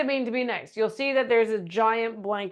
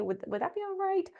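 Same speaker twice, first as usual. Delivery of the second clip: high whispered plea; timid whisper; 10 semitones higher, 7 dB quieter than usual. Shown as a demonstration. Boss whispering a nervous request to her team member. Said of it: "Here's another one".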